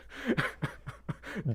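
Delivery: airy burst of laughter; breathy laugh. Real, not acted. Streamer laughing in relief at chat. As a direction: laugh out the tension.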